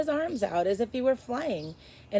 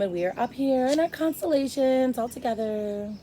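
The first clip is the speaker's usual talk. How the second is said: higher, distant star voice; stars' voice; 5 semitones higher, 4 dB louder than usual. A narrator's reading. Stars boasting to Edward, higher voice. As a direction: boast from far above. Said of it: "the stars seem to say".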